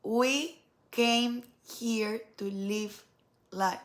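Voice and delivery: word by word, with pauses between; slow emphatic repeat. Real, not acted. Speaker restating the core message to viewers, slow and deliberate. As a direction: draw out "We came here to live life" deliberately.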